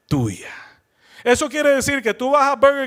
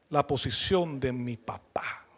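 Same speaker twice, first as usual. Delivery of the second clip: susurro; 8 semitones lower, 12 dB quieter, slower than usual. Real, not acted. Secreto revelado en voz baja.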